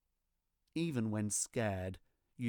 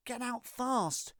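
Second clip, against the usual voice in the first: urgent alarm cry; 11 semitones higher, slower than usual. A narrator's reading. Alarm's meaning voiced urgently.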